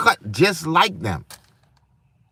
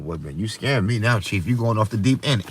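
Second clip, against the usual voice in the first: mock worried friends; 7 semitones lower, 4 dB quieter than usual. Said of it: "They call it out".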